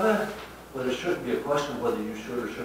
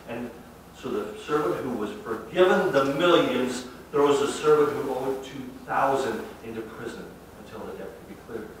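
usual speaking voice

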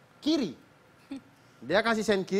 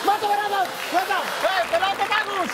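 loud, high, laughing cheers; host celebrating the joke with the audience; excited cheering; 8 semitones higher, 5 dB louder than usual. Real, not acted. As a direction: whoop for the crowd.

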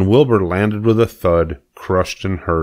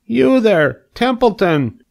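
calling out; slower than usual. Acted. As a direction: call across the yard. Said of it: "he called".

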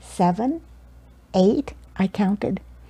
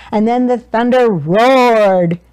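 booming narration; 4 dB louder than usual. A narrator's reading. Thunder sound built into narration.

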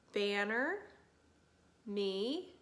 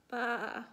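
drawn-out lamb "baa"; lamb's bleat. A narrator's reading. Little lamb shivering in the night air, bleating. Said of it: "The little lamb shivered".

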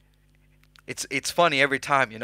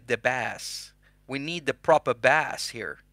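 ordinary delivery

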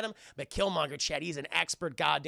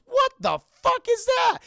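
very high, loud shocked yell; a hammed-up shocked scream; shocked shout; 11 dB louder than usual. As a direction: shriek it in cartoon disbelief. T'Challa spotting the ring.

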